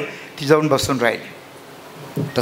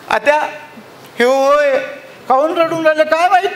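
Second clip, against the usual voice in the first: wife calling back; 10 semitones higher than usual. Acted. Wife calls back to her mother-in-law. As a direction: answer the old woman across the dark.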